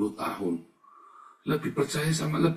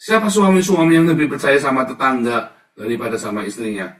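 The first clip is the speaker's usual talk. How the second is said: loud, much higher, fiery sermon delivery; preaching at full force; 5 semitones higher, 9 dB louder than usual. Acted. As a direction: warn the flock with fire. (khotbah lantang penuh semangat)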